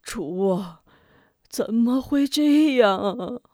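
anguished outrage. Acted. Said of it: "咬牙跺脚".

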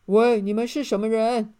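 loud, commanding guard voice; guard soldier. A narrator's reading. Castle guard challenges the two newcomers, loud soldier voice. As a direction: challenge them like a sentry. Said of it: "守卫的士兵说".

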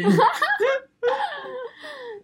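high-pitched giggling laugh; high giggle. Real, not acted. Laughing about cute guys.

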